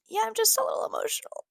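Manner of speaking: very high, squeaky imitation of holding it in; mock teary admission; retold near-tears. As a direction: pitch it way up as if about to cry. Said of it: "then I start crying".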